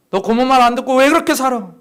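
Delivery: 높고 크게 꾸짖는 흉내; 고모처럼 목청 높여 나무라듯; 호통치는 고모. Retold or imitated. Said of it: "고모가 호통을 치기 시작해요". (큰 소리로 호통치는 흉내)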